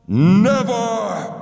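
high, loud roared never; roared refusal. Acted. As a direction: roar it out in fury. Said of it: "roared Tāpapa".

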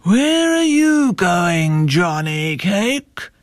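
big slow bear voice; storybook bear calling out a slow question. A narrator's reading. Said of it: "The bear said".

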